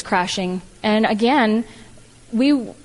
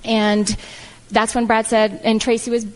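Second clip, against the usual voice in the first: ordinary talk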